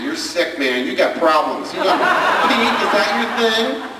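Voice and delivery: high, laughing sneer; high, laughing mock teen taunt. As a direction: needle him like a snide teenager. Kid mocking dad.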